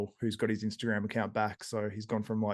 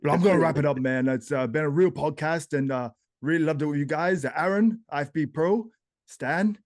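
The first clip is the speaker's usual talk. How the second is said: put-on accent drifting into Jamaican, raised pitch; hearty sign-off in an impression slipping towards a Jamaican accent; imitation voice sliding into Jamaican, lifting the names; Samson; 5 semitones higher, 5 dB louder than usual. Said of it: "I'm turning into Jamaican now".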